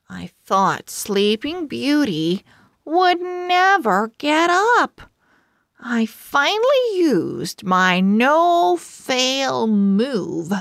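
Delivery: ordinary delivery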